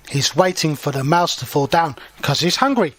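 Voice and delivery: usual speaking voice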